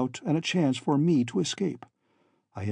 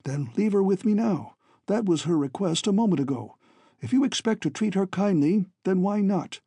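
slightly higher, abrupt challenge; 6 semitones higher than usual. A narrator's reading. Father demands his daughter be left with him, abrupt. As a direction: press the demand suddenly, as a father. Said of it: "Doctor Kent said abruptly".

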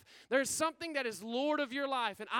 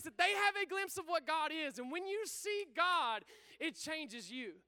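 usual speaking voice

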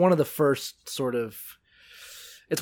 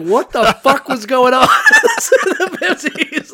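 loud, high, laughing outburst; laughing disbelief; 9 semitones higher, 11 dB louder than usual. Retold or imitated. Remembering watching the documentary, loud laughing disbelief. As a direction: blurt it out through laughter. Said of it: "be like".

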